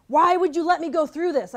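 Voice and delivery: loud, high, desperate plea; anguished cry. Retold or imitated. Slamming fist on the bed, crying out to God. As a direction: demand an answer through frustration. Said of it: "I slammed my fist on the bed".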